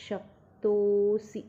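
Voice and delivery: "शक्तोऽसि" slow, with "तो" drawn long; stretched avagraha. Shown as a demonstration. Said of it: "त को लंबा stretch करना है".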